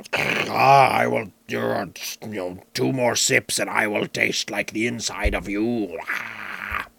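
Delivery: low demonic snarl; demonic beverage. Pretend. IPA threatening the drinker, demonic snarl. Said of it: "the little demonic snarl at the end there".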